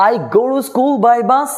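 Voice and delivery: each word spaced out slowly; slow teacher dictation; slow, careful model sentence. Shown as a demonstration. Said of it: "উচ্চারণটা সুন্দর করতে হবে".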